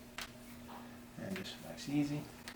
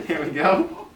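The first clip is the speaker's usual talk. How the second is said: laughing; 5 semitones higher, 9 dB louder, slower than usual. Real, not acted. Laughing during adjustment.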